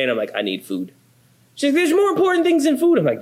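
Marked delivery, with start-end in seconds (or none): 0.3-0.9 low, drained mumble of a starving man; hangry mumble. Retold or imitated. Husband to wife, too hungry to care, drained mumble. 1.7-3.0 high, quick imitation of his wife scolding; mock wife. Husband imitates wife scolding him about food, high quick mock voice.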